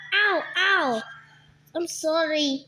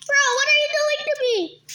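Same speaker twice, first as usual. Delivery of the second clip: higher, fast, outraged; indignant shout; 10 semitones higher, faster than usual. Real, not acted. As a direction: cry out at being attacked. Upset at being eaten.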